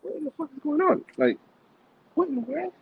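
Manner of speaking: high-pitched, baffled mutter to yourself; comically high, mock-stunned questioning; bewildered disbelief. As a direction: act out being totally lost, voice way up high. Acting out his bewilderment.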